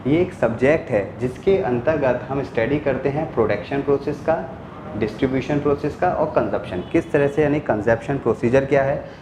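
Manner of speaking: normal talking voice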